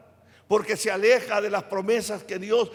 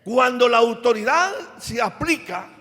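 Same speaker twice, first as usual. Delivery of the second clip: building to a louder climax; preaching; 3 dB louder than usual. Acted. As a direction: rise to a louder close on paz. Predica hasta el clímax. (predicación que crece hasta un final más fuerte)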